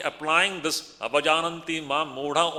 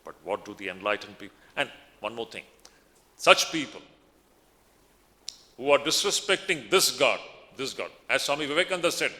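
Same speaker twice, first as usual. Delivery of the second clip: ordinary talk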